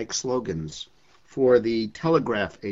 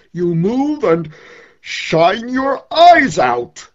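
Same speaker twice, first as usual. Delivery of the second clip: loud, higher, reassuring then rousing; the sun's reply; 8 semitones higher, 11 dB louder, slower than usual. Acted. The sun reassuring the poet about shining, loud rousing speech. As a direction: comfort the poet, then build to a big finish. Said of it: "he says".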